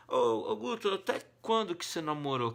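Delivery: high, loud teasing question from a pal; curious friend asking. Retold or imitated. Friend teasing Guto about when he dated, high and loud. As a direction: call him out like a nosy friend.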